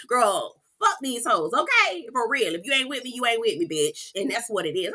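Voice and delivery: normal talking voice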